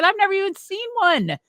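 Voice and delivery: can't believe what she's being asked; incredulous. Retold or imitated. Deuce telling Mike she has never seen a truck, incredulous. Reacting to monster truck offer.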